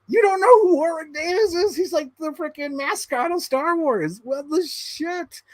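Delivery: high stunned rant; incredulous. Retold or imitated. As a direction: brother to sister about Warwick Davis, high stunned disbelief. Shocked at sister.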